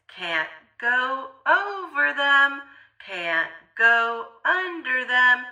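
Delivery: ordinary delivery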